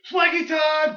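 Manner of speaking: high, shouted catchphrase; mock victory yell; Jack Swagger. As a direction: holler it as a hammed-up wrestler. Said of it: "my boy Jack Swagger said".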